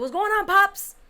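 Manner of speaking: high, loud, breezy hello; imitating a big familiar greeting; retold hearty greeting. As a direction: call it out to someone on speakerphone. Retold greeting to his dad on speaker.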